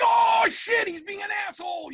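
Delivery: very high panicked yelp; freaking out. Retold or imitated. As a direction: replay a loud burst of panic, then drop the volume. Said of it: "freaking out".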